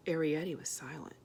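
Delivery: ordinary delivery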